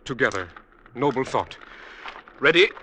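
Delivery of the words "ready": rising, louder heave-ho call; effortful countdown. Acted. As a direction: call the cue as you both heave. Brothers heave wood onto pyre together.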